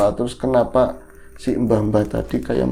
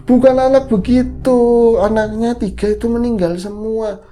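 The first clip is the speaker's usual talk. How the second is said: put-on high, loud insistent old woman; voicing the grandma; 10 semitones higher, 5 dB louder than usual. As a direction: correct him firmly. Grandma explains in story. (nenek menegaskan dengan suara tinggi)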